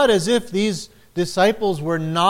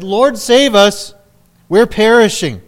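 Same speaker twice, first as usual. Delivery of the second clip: a frightened disciples' plea, raised high; desperate plea; 5 semitones higher, 8 dB louder than usual. Retold or imitated. Disciples waking Jesus in the storm, desperate loud plea. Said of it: "in near despair".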